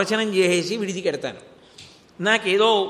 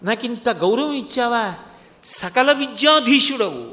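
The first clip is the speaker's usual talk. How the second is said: overwhelmed with joy; 4 semitones higher than usual. Acted. Saint discovering Shiva wrote his hymns overnight, rapturous and higher. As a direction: cry out to Shiva in stunned devotion. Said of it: "ఆనందం ఆగలేదు ఆయనకి, ఉద్వేగం పొందేశాడు".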